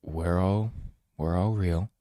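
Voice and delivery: soft, quiet and calm; gentle reassuring. Acted. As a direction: soothe a scared stranger. Calming a frightened woman.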